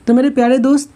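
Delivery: usual speaking voice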